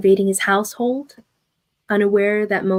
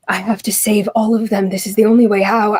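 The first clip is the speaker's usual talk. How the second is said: lightly voiced figure, whispering in despair; a hint of a hushed, broken man; desperate young mage. A mage alone with his failure, murmuring in despair.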